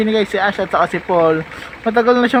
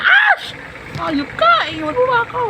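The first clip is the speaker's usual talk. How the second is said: calling after cat. Real, not acted. Calling kitten as it leaves.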